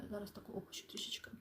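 hushed, quick mutter to oneself; barely audible working aside; quiet aside. Real, not acted. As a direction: say it under your breath, almost whispered. Aside while adjusting the screen.

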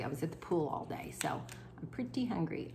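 half-whispered aside, mouth near food. Real, not acted. Vlogger whispering to viewers over her dinner plate. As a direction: whisper it like a little confession. Eating on camera, drops to whisper.

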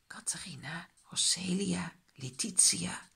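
very soft secret whisper. A narrator's reading. Child secretly calls on magic helpers, whispered incantation. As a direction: whisper each name separately, like a spell. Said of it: "en fluistert".

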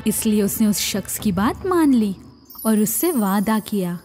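ordinary delivery

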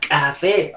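"Café" con K explosiva; K marcada. Shown as a demonstration. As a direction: exagera el golpe de la K en "Café". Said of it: "Con la K". ("Café" con ka fuerte)

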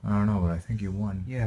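usual speaking voice

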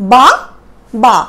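"বা, বা।" said as two separate beats, each বা on its own push of breath with a pause between; split into syllables. Shown as a demonstration. Teacher splitting বাবা into its two syllables for students, in separate beats. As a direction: break "বা, বা।" into two distinct breaths, one বা at a time. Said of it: "দুটো ঝোঁক দিয়ে বলতে হবে".

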